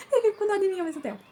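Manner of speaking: real laugh breaking into the words; high-pitched, laughing as you talk. Real, not acted. Telling viewers about the pitying husband, laughing.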